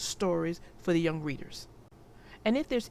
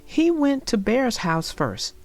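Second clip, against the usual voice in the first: put-on clear, lively read-aloud for young children; storybook narration; 8 dB louder than usual. A narrator's reading. Narrator reading Franklin's Blanket to young viewers, storybook read-aloud. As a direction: tell the story warmly to little listeners.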